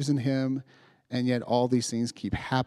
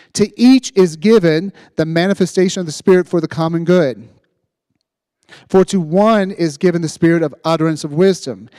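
loud, high, fervent preaching; preaching at full force; 5 semitones higher, 11 dB louder than usual. Acted. Pastor urging the congregation to act in faith, loud fervent preaching. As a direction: preach it out with everything you've got.

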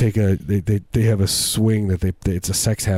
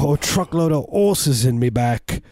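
mock Australian accent; 7 semitones higher than usual. A tourist explaining his truckload, in a mock Australian accent. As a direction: brag about the horses like a silly Aussie. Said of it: "this accent".

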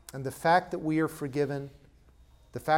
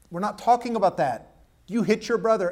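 louder, higher firm rebuke; stern parent scolding; 6 semitones higher, 6 dB louder than usual. Retold or imitated. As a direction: correct the child sharply.

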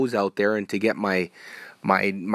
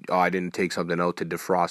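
ordinary delivery

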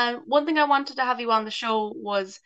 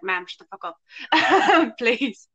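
louder, drawn-out mock telling-off breaking into laughter; laughing mock exasperation; 4 dB louder, much slower than usual. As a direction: plead through a laugh, playfully exasperated. Mock plea to noisy mum, laughing.

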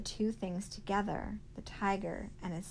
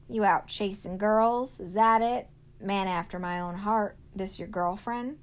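loud, teasing backwoods man; bullying good old boy; 2 semitones higher, 8 dB louder than usual. Acted. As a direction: rib the boy, big and brash. Beauchamp's loud, mocking talk.

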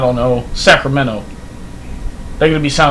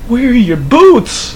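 high, slow mocking question; lightly voiced Brit teasing a Texan; mock British voice; 8 semitones higher, much slower than usual. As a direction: ham up a Brit asking about boots. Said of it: "Everybody be like".

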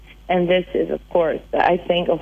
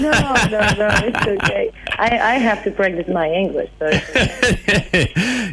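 laughing; 4 semitones higher than usual. Real, not acted. Laughing off the apology.

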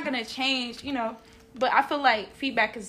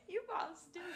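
high, laughing through the words; laughing tease; 5 semitones higher, 19 dB quieter than usual. Real, not acted. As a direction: toss it off mid-laugh. Laughing at both hosts.

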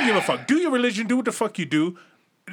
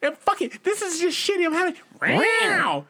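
put-on high, whiny girl voice, much higher; mock moody girl; 12 semitones higher than usual. Mocking a girl having a bad day, high and whiny. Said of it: "And she's like".

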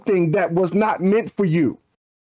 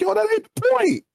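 1 exasperated retort. Retold or imitated. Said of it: "I'm like".

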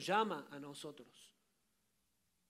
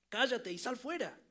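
a firm, commanding rebuke, louder, pitched up; stern command; 4 semitones higher, 4 dB louder than usual. Acted. Jesus rebukes the demons, commanding and loud. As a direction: cast them out with authority. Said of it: "Jesús le dijo a los demonios".

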